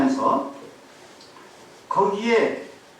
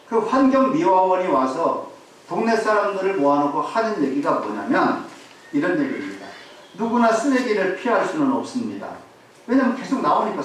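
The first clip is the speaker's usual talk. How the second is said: normal talking voice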